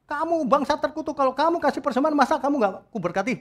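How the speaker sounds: high-pitched exasperated rebuke; angry scolding. Acted. God scolding the cursed nation, exasperated. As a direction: scold them in a high, heated voice. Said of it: "Tuhan marah loh".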